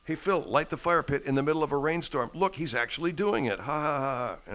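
loud, teasing heckler; mocking taunt. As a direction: jeer at him and cackle. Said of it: "that's how smart I am".

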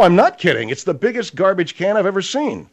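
ordinary talk, nothing marked

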